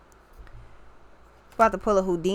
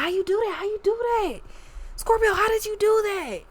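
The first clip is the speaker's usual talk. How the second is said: much higher, squealing disbelief that rises as it repeats; astonished onlookers; 13 semitones higher than usual. Retold or imitated. Everyone asking Scorpio how they did it, in high astonished disbelief. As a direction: play the dumbfounded crowd begging to know the trick. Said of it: "Everybody's like".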